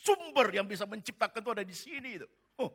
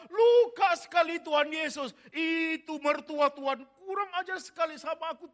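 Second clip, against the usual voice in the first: anguished prayer cry; 7 semitones higher, 8 dB louder than usual. Pretend. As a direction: pour out years of hurt, crying out. Acted anguished honest prayer.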